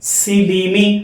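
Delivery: Silly me drawn out very slowly, each syllable held; slow syllable drill. Shown as a demonstration. Teacher to pupils, stretching silly me out slowly. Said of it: "तीनों को मिला के इस तरह पढ़ेंगे".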